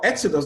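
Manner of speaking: Exodus stressed as EX-o-dus; stress on first syllable. Shown as a demonstration. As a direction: hit the EX of Exodus. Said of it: "on the first syllable".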